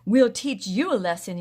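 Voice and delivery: loud mock-threatening yell; yelled threat. A narrator's reading. Ted yelling a mock threat at Rusty. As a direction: shout it as you run at them. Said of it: "yelled Ted".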